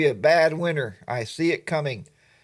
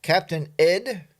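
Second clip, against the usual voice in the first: ordinary delivery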